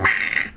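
bleating cat meh; mock cat complaint. The cat complaining with a bleat. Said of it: "And she's like".

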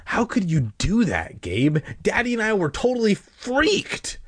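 high, exasperated scolding; indignant cousin's high-pitched complaint; acted reproach. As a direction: tell him off, pitch climbing. Cousin scolds him for getting lost.

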